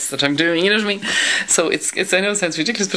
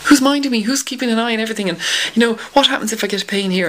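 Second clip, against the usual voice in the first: anxious inner voice; 7 semitones higher than usual. Retold or imitated. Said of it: "And you're going".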